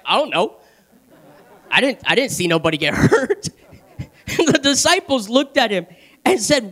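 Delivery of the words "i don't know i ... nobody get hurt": low, halting mock denial with laughter; imitated innocent kid. Sister beside the crying child, feigning innocence. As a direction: play the kid denying it, breaking into laughter. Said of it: "and she's like".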